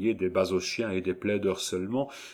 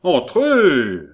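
very loud, high, drawn-out call; emphatic, impatient summons; more emphatic nasal shout; 7 semitones higher, 12 dB louder than usual. A narrator's reading. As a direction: call out insistently through the nose. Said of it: "avec un accent plus prononcé".

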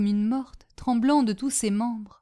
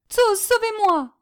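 high, slow frightened cry; desperate call; 13 semitones higher, 6 dB louder than usual. Acted. Niece calling Tzoz to save her, high frightened cry. Trapped girl calling for rescue.

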